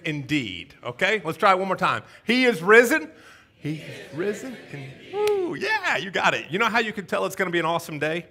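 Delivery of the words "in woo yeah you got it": ordinary delivery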